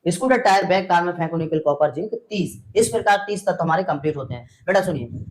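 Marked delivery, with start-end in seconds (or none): none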